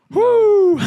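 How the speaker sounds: high loud whooping cheer; hype whoo. Real, not acted. Hyping pre-workout.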